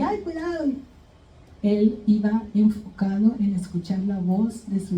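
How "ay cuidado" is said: high-pitched pretend shout; mock warning yell. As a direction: imitate a crowd's cry, high but softened. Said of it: "toda la gente estaba gritando y diciéndole".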